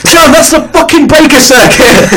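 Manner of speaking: high, exasperated scolding of John; hammed-up scold; mock scold; mock exasperated telling-off. Acted scolding quote.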